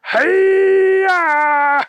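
long, loud, high-pitched yell; karate battle cry. Acted. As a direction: let out a drawn-out martial arts cry.

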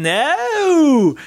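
no stretched high and loud through many vowels; drawn-out Australian no. Retold or imitated. Retelling a TV star's Australian no, stretched long. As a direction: drag no out like an Australian. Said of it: "she says no with, like, every other vowel".

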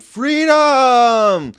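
a high, loud, hammed-up battle-cry yell; William Wallace's scream. A patriot's dying cry at his execution, a high hammed-up scream. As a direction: belt it out like a martyr's last cry. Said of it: "And he screamed".

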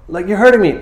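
high, loud mock complaint; acted yelp of protest; protesting blind man. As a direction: pipe up as the man being hurt, hammed-up.

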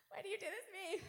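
high, whiny comic protest; mock anguish. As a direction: wail as if spiders wronged you personally. Mock pleading about giant spiders.

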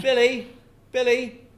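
put-on loud drunken shout of Billy. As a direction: shout the name across the hall. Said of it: "I'm shouting my own name".

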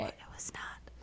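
breathy whisper, barely voiced. Real, not acted. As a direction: whisper it like a secret. Hushed joke about the noise.

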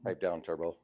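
low, soft impression of a buddy; understated low impersonation; Brett. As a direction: drop it low and quiet, almost under your breath. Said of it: "This is Brett".

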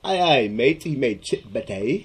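hammed-up, badly faked British accent; horrible British accent. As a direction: butcher a British accent on purpose. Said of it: "that was a horrible accent as well".

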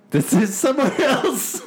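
high, squeaky retort through a laugh; mock indignant reply. Friend jumping in with the dog owner's squeaky comeback. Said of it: "And I go".

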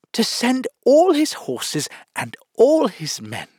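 high, loud eager cut-in; interrupting. A narrator's reading. Alice finishes Humpty's sentence unwisely, interrupting. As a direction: jump in, emphatic and high. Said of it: "Alice interrupted".

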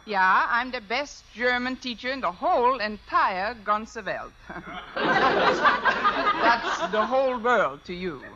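put-on slow comic German accent. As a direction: ham up a German teacher. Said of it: "If I can do a decent German accent".

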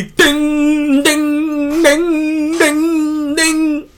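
high sung bell dings; imitated bell ding. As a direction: ring out like a bell. Bell after crier.

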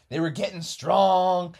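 ordinary delivery, nothing marked

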